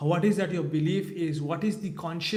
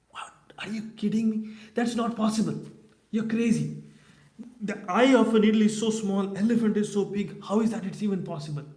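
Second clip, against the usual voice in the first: mock the answer as crazy nonsense; a storyteller playing a skeptic who cries you're lying; incredulous scoffing; 4 semitones higher than usual. Brahmana scoffs at the impossible answer.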